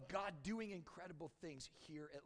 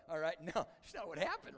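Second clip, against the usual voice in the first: ordinary talk, nothing marked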